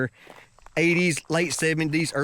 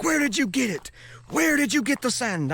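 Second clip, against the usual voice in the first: high-pitched aggressive interrogation; imitated angry demand; 12 semitones higher than usual. Guard questions traveler about the sand, imitated angry demand.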